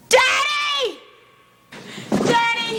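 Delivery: high yelled Daddy; shouted call. Acted. Trapped man yelling for his father. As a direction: cry out for Daddy, panicked.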